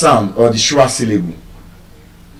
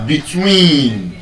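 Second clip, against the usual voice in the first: slow "between" with a long TWEEN; weight on the end of "between"; stress on last syllable; 3 dB quieter than usual. Shown as a demonstration. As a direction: draw out the second syllable of "between". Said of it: "You can see the stress. Last syllable".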